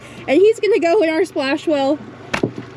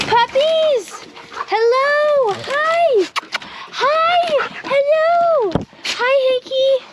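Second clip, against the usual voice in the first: puppy talk; 11 semitones higher, much slower than usual. Real, not acted. Greeting excited dogs at home.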